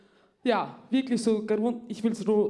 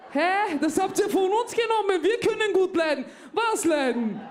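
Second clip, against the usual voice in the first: indignant outburst; 8 semitones higher than usual. Acted. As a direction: burst out offended at the theft. Indignant retort to her friend.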